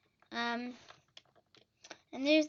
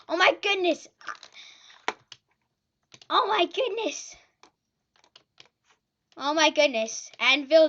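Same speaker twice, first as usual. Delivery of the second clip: loud frantic exclamations; panicked shouting; 7 dB louder than usual. Real, not acted. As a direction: yell in frantic disbelief. Anvils raining in game.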